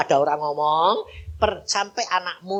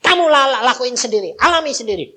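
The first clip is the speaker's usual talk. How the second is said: retold angry retort; 8 semitones higher, 8 dB louder than usual. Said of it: "mulut saya marah".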